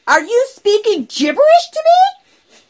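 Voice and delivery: high, loud, incredulous mother's voice; shocked mom. Retold or imitated. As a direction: catch the kid out in disbelief. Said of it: "and she said".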